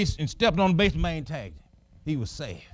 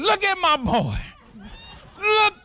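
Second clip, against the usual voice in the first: high, thrilled call; proud excitement; 11 semitones higher than usual. Retold or imitated. Father watching his son run the bases, excited. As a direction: point him out with pride.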